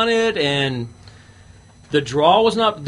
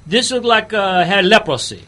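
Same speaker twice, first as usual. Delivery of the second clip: hammed-up Louisiana bayou accent; put-on Cajun accent. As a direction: play a Cajun gator hunter, accent laid on thick. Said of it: "I still don't know whether it's Cajun".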